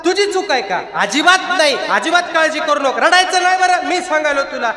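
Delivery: high, gentle voice comforting a weeping animal; tender concern. Acted. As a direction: soothe her like a frightened child. Tukaram consoling the buffalo. (रडणाऱ्या म्हशीला प्रेमाने समजावणे)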